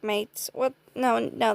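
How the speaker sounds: normal talking voice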